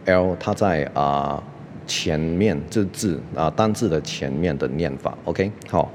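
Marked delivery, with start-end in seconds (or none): none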